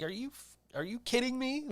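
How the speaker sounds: high-pitched, exasperated disbelief; retold incredulity. Worker reacting to headlines sent late at night, incredulous.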